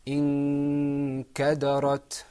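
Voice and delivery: "انكدرت" loud and slow, n held in a long hum; ikhfa model. Shown as a demonstration. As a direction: chant "انكدرت" loudly, stretching the hidden n. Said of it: "let's practice that word".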